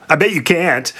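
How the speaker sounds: high, taunting interjection; gleeful. A narrator's reading. Sphinx interrupts Oedipus as he thinks, gleeful. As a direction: cut in with gloating glee, pitch up. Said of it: "the Sphinx gleefully interrupted".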